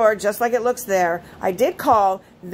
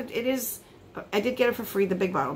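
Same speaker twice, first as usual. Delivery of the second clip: ordinary talk, nothing marked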